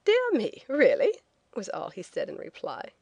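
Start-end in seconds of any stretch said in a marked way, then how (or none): 0.0-1.2 a high, mock-shocked "Dear me"; hammed-up prim surprise; startled Englishman. Englishman answering her joke with a startled squeak.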